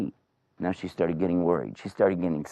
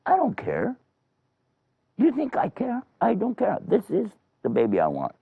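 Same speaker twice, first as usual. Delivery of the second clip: high-pitched, stubborn woman voice; put-on high-pitched insistence; insistent woman; 13 semitones higher than usual. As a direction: dig in defiantly in a high voice. Acted woman insisting on baby.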